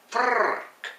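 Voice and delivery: frrrk with a long rolled R, high; frrrk, R rolled like a Scot. Shown as a demonstration. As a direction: trill the R in frrrk. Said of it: "how many R's you put in there".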